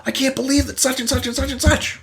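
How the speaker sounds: mock telling someone you're pissed off; mock angry. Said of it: "Anger is when you say".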